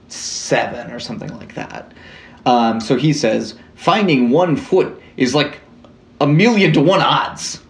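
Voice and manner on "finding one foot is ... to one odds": bad Canadian accent. Host quotes a Mountie corporal, in a bad Canadian accent. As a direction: play a corny Mountie who loves his own joke. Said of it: "read this in a bad Canadian accent".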